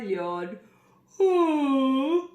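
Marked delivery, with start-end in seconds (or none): 1.2-2.3 crocodile's oh. Acted. Crocodile voiced.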